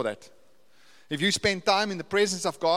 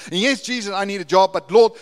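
building, louder prayer to Jesus; fervent loud prayer; 5 semitones higher, 11 dB louder than usual. Acted. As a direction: cry out the prayer at the climax. Climactic prayer in sermon.

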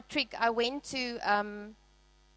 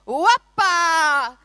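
loud party cry of Opa; cheerful shout; 4 semitones higher, 8 dB louder than usual. Retold or imitated. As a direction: call it out like a celebration. Group cheer while breaking plates.

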